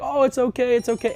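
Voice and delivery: high-pitched, retold "don't worry" tone; mock reassurance. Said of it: "kinda laughing and like".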